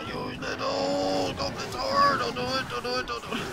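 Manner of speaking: hushed tense pleading; whispered don't do it. Real, not acted. Tense moment, pleading quietly.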